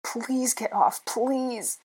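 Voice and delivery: high, drawn-out desperate plea; pitiful high begging. A narrator's reading. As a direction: plead slowly, voice pitched right up. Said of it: "I begged, my throat convulsing".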